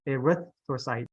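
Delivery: "erythrocyte" with a drawn RITH; stress on second syllable. Shown as a demonstration. Teacher models it for class, second-syllable stress on "erythrocyte". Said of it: "the emphasis on the second word".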